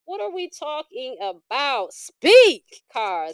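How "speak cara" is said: loud dramatic summons to the cards; calling out. Real, not acted. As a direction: call the card to speak. Calling on a tarot card.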